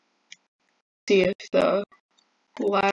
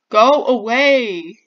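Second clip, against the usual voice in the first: loud, drawn-out slow shout; exasperated yell; 3 semitones higher, 11 dB louder, slower than usual. Real, not acted. Player to the rat chasing them, exasperated yell. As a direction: shout it slowly at a pest.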